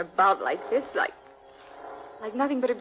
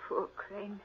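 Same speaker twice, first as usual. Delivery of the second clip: ordinary talk, nothing marked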